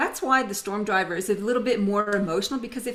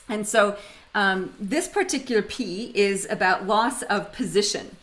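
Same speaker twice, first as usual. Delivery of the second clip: ordinary talk, nothing marked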